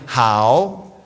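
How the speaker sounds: "hao" in Mandarin, not the English "how". Shown as a demonstration. Speaker showing the audience the correct Mandarin "hao". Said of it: "let's try it again".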